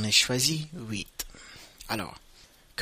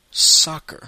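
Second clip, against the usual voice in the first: soccer, louder and higher, SAH-ker; American English; 5 semitones higher, 11 dB louder than usual. Shown as a demonstration. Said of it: "si vous parlez à un Américain".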